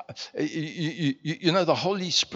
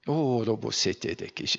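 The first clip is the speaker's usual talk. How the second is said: speaking in tongues; 4 semitones lower, 6 dB quieter than usual. Shown as a demonstration. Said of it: "to speak in tongues".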